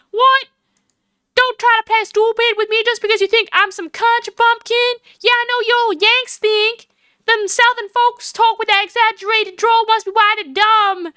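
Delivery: high, indignant Southern drawl; exaggerated Southern drawl. Proud Southerner rebukes Yanks for thinking her dumb, Southern drawl. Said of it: "I've given her the one with the drawl".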